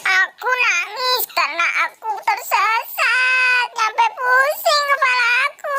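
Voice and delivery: high, tearful, rising into a wail; crying. Acted. Mampang explains to friends he is lost, crying high and tearful. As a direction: blubber like a lost child. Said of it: "sambil nangis".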